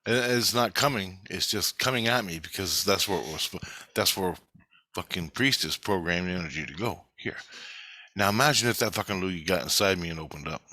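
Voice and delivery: usual speaking voice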